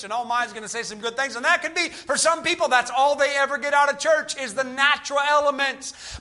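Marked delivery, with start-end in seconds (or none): none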